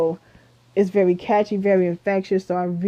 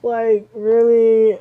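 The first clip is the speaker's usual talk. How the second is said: exasperated; 3 semitones higher, 7 dB louder, much slower than usual. Real, not acted. Exasperated at stripping idols.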